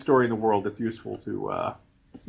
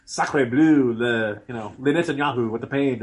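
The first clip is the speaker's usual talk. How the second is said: put-on Sarkozy French accent; slower than usual. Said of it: "You're like".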